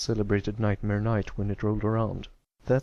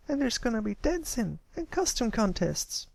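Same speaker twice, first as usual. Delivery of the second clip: high filly voice, cheerful; chiming-in filly; 13 semitones higher than usual. Acted. Sweetie Belle chiming in to Pipsqueak, cheerful high filly voice. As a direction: jump into the chat. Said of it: "Sweetie Belle chimed in".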